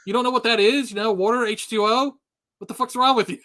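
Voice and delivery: mock outraged customer; exasperated disbelief. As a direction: act baffled at the cashier. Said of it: "I'm like".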